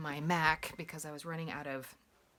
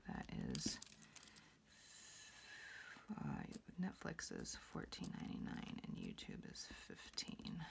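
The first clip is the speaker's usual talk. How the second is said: soft, lower whisper, almost to herself; quietly fix the mistake under your breath; whispered self-correction; 12 dB quieter than usual. Real, not acted. Vlogger correcting the Netflix and YouTube amounts, whispering to herself.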